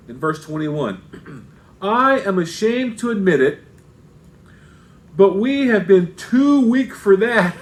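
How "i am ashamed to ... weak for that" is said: mock-confession with emphatic stresses; sarcastic mock admission. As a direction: read it as Paul's dry sarcasm, punching the key words. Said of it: "This is another touch of sarcasm here".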